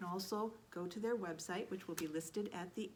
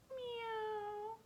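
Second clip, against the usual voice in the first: high meow; cat meow; 13 semitones higher, 3 dB quieter than usual. Acted. Puppy meowing for the vet, high and catlike.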